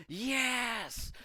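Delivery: high, celebratory yell; imitated cheer of kids freed for summer; elated cheer. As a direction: cheer like school just let out. Kids cheering summer break's start.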